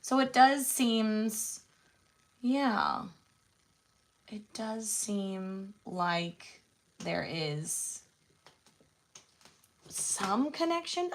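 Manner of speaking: ordinary delivery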